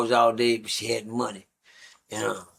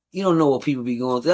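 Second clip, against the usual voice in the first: normal talking voice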